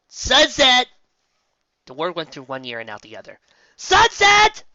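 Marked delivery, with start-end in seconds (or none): 0.1-0.9 high, loud call; shouting name. Acted. Friend calling her name. 3.8-4.6 high, loud call; shouting name. Acted. Friend calling her name.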